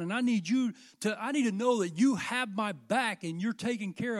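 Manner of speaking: loud, driving sermon cadence; fiery preaching. Acted. Preacher challenging the congregation to sacrifice, loud and driving. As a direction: build the challenge line by line.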